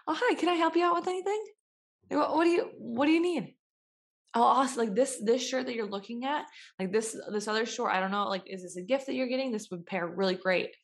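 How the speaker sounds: bubbly store clerk pitch; eager salesclerk. Retold or imitated. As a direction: sell it like an eager retail clerk. Her own salesclerk voice.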